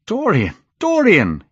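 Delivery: high, alarmed call; crying out. A narrator's reading. Painter catching Dorian's hand, crying out in alarm. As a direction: call the name urgently, pitch leaping. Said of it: "he cried".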